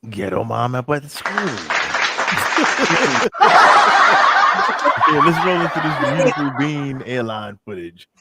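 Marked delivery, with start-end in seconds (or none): 1.4-5.0 loud, high burst of laughter; real, drawn-out belly laugh; big laugh. Real, not acted. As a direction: crack up long and loud. Reacting to a funny moment.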